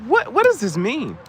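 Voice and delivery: high, baffled mock reaction; confused reader. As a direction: react high and puzzled to the shirt. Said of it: "They can be like".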